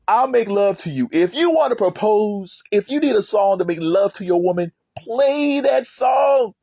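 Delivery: excited gushing. Real, not acted. Fan urging listeners to play that love song, excited gushing. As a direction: belt out the praise, stretching the last words.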